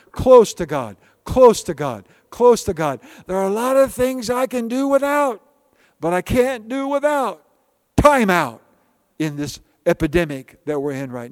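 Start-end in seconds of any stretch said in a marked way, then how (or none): 0.1-3.0 louder repeated close to God; preaching refrain. Real, not acted. Preacher driving the promise home to the church. 3.3-8.6 rising refrain. Real, not acted. Preacher lifting the church with his refrain.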